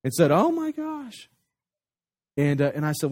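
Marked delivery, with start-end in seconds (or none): 0.3-1.3 high-pitched, alarmed yelp at normal volume; retold scream, pitched way up; mock scream. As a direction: imitate a panicked squeal without really screaming. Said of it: "James kinda screamed".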